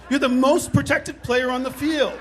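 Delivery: exasperated dad. Retold or imitated. Father scolding his son.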